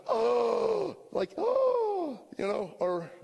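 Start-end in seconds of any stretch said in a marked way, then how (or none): 0.1-0.9 awed gasp. Retold or imitated. Adam and Eve in garden. 1.4-2.2 awed gasp. Retold or imitated. Adam and Eve in garden.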